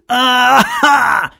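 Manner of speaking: high cry of pain. Acted. Semyon struck by the bandit, pained cry. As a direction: cry out as the blow lands. Man struck while shouting.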